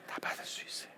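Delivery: soft whispered emphasis. Real, not acted. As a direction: whisper the point to draw them in. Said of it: "whispered key point".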